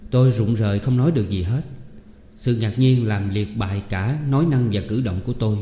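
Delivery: ordinary delivery, nothing marked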